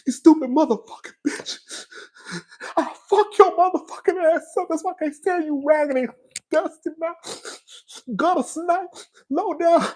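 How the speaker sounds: high-pitched mock-sobbing tirade; broken, weepy insults, pitched high; crying while cussing. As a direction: cuss someone out through put-on tears. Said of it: "that'll cry and cuss your ass out at the same time".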